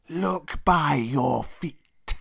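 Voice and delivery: very slow serpent voice; long-drawn, ominous cobra; menacing old cobra. Acted. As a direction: stretch each word into a warning. Cobra warns Mowgli.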